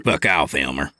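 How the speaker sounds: mild grumble; understated grumpy trucker; grumbling. A narrator's reading. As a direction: grumble it at a teasing buddy. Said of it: "Lewis grumbled".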